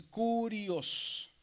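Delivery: "curios" drawn out very slowly, long first syllable; divided into syllables. Shown as a demonstration. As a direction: stretch "curios" syllable by syllable, stressing cu. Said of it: "si la dividimos".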